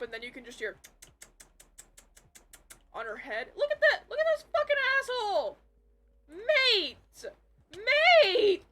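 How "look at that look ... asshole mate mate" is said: high, loud outraged yell; full real shout of disbelief at the screen; yelling at the game. Real, not acted. Gamer yelling at an enderman on screen, loud outrage.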